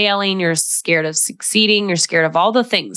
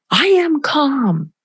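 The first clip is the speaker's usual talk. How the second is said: high, slow, worked-up protest; mock not calm; 9 semitones higher, much slower than usual. Upset person told to wait until calm, mock indignant protest. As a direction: insist on it in a raised pitch, drawn out. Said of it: "This is the red zone".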